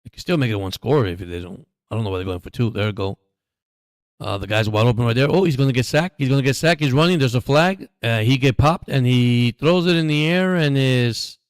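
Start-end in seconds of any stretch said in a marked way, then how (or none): 4.2-11.4 rising, louder live call as the play unfolds; excited play-by-play. Real, not acted. Watching the game, calling the chaotic play out loud excitedly.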